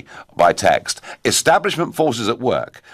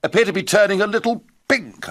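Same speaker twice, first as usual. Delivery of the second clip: put-on a mild, half-hearted try at a plummy upper-class English accent, higher; Jacob Rees-Mogg accent; 10 semitones higher than usual. Host quoting an MP to listeners in a faint posh English accent. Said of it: "I can't quite do the accent".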